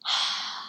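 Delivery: long unvoiced exhausted sigh; weary sigh. Real, not acted. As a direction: breathe out all the tension. End of venting.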